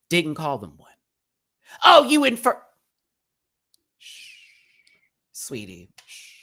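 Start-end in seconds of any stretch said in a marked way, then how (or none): 1.8-2.6 high, loud mocking squawk; mocking mimicry. Host mimicking an online critic's accusation, high loud mockery. 4.0-6.3 condescending hushing. Pretend. Host silencing an online critic, soft condescending hush.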